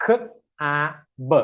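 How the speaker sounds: three separate sounds with gaps between; slow sounding-out. Shown as a demonstration. Teacher has learners blend slowly first, sounds spaced out. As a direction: sound it out slowly, one sound at a time. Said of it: "slow ആയിട്ട്".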